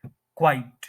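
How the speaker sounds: "क्वाइट" as kwite, meaning quite; one-syllable word. Shown as a demonstration. Teacher introducing the different word, one-syllable pronunciation. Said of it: "बिल्कुल different है".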